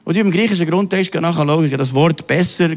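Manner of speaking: ordinary talk, nothing marked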